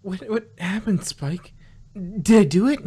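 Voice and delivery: alarmed, rising to a loud cry; suddenly panicked; startled. Acted. Twilight asking Spike what happened, alarmed. Twilight wakes after spell.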